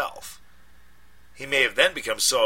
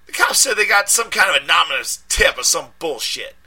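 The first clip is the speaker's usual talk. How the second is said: high, loud street voice in a panic; panicked friend; 8 semitones higher, 10 dB louder than usual. A narrator's reading. Deshaun panicking down the phone at his friend, loud and high. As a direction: yell it like you're scared and angry.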